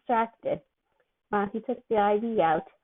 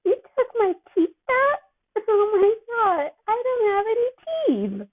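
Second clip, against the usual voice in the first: much higher, louder tearful wail; drugged crying; 13 semitones higher, 4 dB louder than usual. Acted. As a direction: bawl like a loopy kid who thinks his teeth are gone. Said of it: "Peter's eyes began to water".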